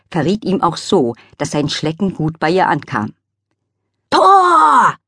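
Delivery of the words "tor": sudden loud high shout; father shouting at the football goal. Acted. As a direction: yell "Tor!" at the TV. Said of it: "schrie plötzlich Lillys Vater".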